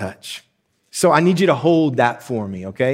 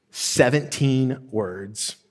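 normal talking voice

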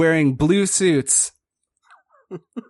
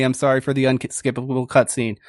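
normal talking voice

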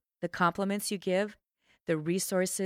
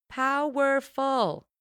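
"Powerful." slow, louder and higher, drawn out; powerful delivery; 7 semitones higher, 6 dB louder, much slower than usual. Shown as a demonstration. Coach showing listeners a powerful voice, slow and loud. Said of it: "How you make others feel when you talk".

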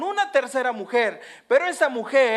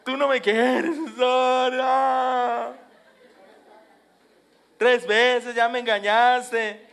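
louder, half-shouted nagging reproach; reproachful complaint; 2 semitones higher, 4 dB louder, much slower than usual. Acted. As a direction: play Delilah scolding Samson with a mock shout. Delilah reproaching Samson.

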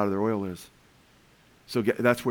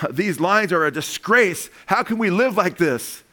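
slow, loud, higher complaint; angry complaint; 7 semitones higher, 4 dB louder, slower than usual. Retold or imitated. Man in the Soviet potato line complaining, slow loud outrage. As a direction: protest the potato lines, loud and drawn out. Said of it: "somebody said".

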